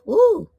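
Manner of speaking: loud high whoop of joy; joyful whoop. Real, not acted. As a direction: cheer it out. Cheering at a message.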